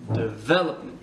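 "development" with the second syllable VEL stressed. Shown as a demonstration. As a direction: hit VEL in "development", rest light. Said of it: "on accentue le V". (accentué sur « vel » dans « development »)

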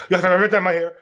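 mocking the manager's voice. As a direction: imitate the arrogant manager barking orders. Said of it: "he's talking like that".

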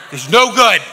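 "It's no good" punched high and loud; loud mock scolding. Playing the flesh, scolds the volunteer loudly. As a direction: bark "no" up top.

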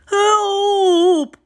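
high, loud cry for help. A narrator's reading. Toad as his list blows away, crying out. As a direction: call out in a high-pitched panic. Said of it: "cried Toad".

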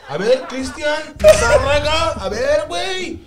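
high, loud, slow calling out; laughing call-out. Real, not acted. Guest calling out to a friend, laughing. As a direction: shout the name through laughter.

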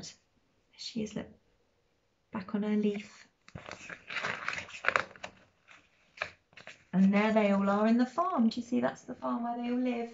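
soft bedtime voice; hushed. Real, not acted. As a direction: quietly point at the picture. Quiet pointing at pictures.

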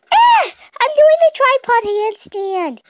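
very high, loud strained cry; straining cry. Acted. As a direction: cry out while holding the handstand. Crying out during handstand.

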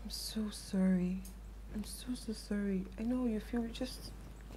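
soft whispered apology; whispered sympathy. Acted. Friend consoling heartbroken Henry, in a whisper. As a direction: breathe the sorry out gently.

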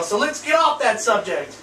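ordinary talk

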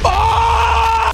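sudden high panicked scream; startled scream. Real, not acted. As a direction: shriek in alarm. Sudden attack in the Nether.

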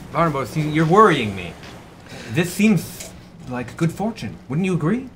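high, halting captain seeking reassurance; uneasy captain. Acted. Captain asking Barnabas about good fortune, high and uneasy. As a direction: lift the pitch and press gently for agreement. Game master plays worried captain.

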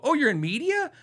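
high-pitched questioning echo, rising at the end; imitated intrigued surprise. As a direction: play the impressed barista probing the claim. Imitates barista's curious follow-up question.

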